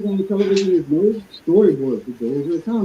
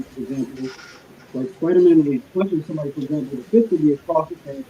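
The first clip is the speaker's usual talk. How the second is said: ordinary delivery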